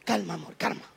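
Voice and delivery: soft, low hush; calm her down tenderly; husband quieting his crying wife, soft and low; soothing. Retold or imitated. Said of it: "deja de gritar a consolar".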